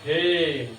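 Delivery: a long, sustained हे; drawn-out vowel. Shown as a demonstration. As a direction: stretch हे over several beats. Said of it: "हे को बोलते हैं देखो ज्यादा समय लगेगा".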